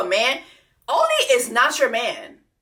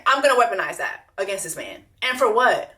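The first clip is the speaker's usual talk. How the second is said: ordinary talk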